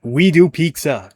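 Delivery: slow, smug mock repeat of We do pizza; mock slogan read, slowed down; mocking the tagline. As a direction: sneer it back in a parody voice. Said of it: "God fucking damn it".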